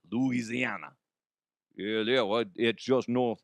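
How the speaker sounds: Louisiana in a mock Cajun accent, stretched out; bad Cajun accent. Shown as a demonstration. Host mocking the local name with a bad Cajun accent. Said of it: "That's a horrible Cajun accent".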